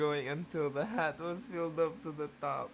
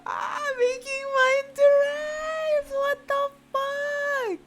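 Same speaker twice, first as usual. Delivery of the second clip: "making mine to rise" squealed very high and slow; squeaky mock read; 7 dB louder, much slower than usual. Shown as a demonstration. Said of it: "Alright, look at it".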